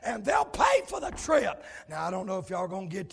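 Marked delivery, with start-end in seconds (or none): 0.0-1.6 loud, driving revival preaching; fiery preaching. Acted. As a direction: preach it hard to the church. Preaching at full force.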